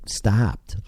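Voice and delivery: ordinary delivery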